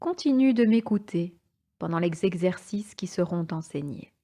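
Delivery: ordinary talk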